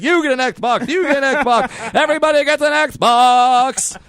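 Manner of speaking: high, loud Oprah giveaway cry; high, loud ecstatic talk show host; Oprah impression. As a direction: shout it like Oprah handing out cars. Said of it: "like I'm freaking Oprah".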